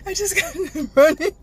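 breathless laugh through the words. Real, not acted.

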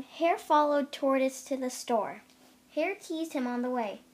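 ordinary delivery

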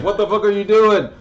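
shocked dad. Retold or imitated. Dad catching his son, outraged yell. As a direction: bark it like a startled father. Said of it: "he was like".

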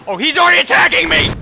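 high, loud, fast panicked yell; terrified horror victim. Real, not acted. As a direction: shriek as he attacks you. Panic as boss attacks.

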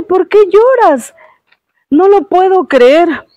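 high, loud cry of disbelief; astonished swallow. Acted. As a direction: gasp it out, unable to believe he weeps. Swallow questions the weeping prince.

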